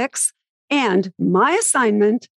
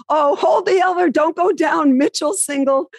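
higher, faster, mock panicked call; urgent retold shout; 8 semitones higher than usual. Reporter retelling the elevator crowd, mock frantic. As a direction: hurry them, as if the game hangs on it. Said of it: "they said".

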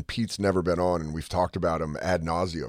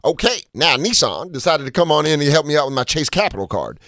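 Kevin Hart hype voice; 9 semitones higher, 7 dB louder than usual. Retold or imitated. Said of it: "'Cause he's like".

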